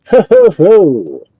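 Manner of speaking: slow, loud, hearty ho ho ho from the crowd; a giant's belly laugh rolling out slowly; big booming laugh. Acted. Stranger laughing at the strongman from the crowd, booming. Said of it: "A laugh like that coming from somebody".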